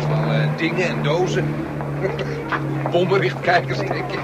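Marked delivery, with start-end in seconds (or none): 1.6-2.9 high breathless giggle; nervous giggle. Acted. Nervous about hidden cargo. (zenuwachtig giechelen)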